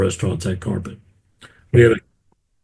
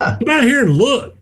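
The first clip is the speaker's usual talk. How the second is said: voicing the guy; 13 semitones higher than usual. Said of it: "Guy goes".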